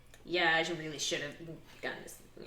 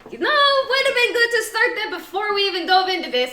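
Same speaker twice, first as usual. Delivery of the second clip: high, loud and laughing; playful shouted telling-off; mock yelled scolding; 11 semitones higher, 8 dB louder, faster than usual. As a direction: holler a complaint, half laughing. Joking shouted scolding between players.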